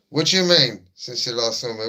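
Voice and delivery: playful offended retort; mock indignation. Real, not acted. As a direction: act scandalised at the question. Mock-outraged reply.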